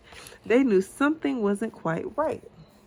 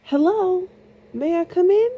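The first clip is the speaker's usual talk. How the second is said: high, louder calling voice; 11 semitones higher, 6 dB louder than usual. A narrator's reading. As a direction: call into the empty cottage like a princess.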